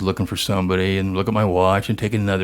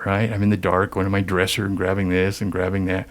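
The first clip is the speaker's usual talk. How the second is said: ordinary delivery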